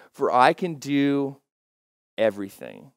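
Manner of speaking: slow, deliberate verse reading. Shown as a demonstration. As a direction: let each word sink in slowly. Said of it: "I like to read it a little bit slower".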